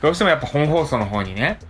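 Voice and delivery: ordinary talk, nothing marked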